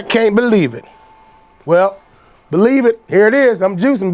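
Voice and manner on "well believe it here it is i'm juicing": normal talking voice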